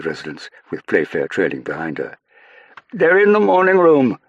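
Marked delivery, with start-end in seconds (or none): 2.9-4.2 high, loud urgent shout. Acted. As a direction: yell it across the grounds, out of breath. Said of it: "he shouted".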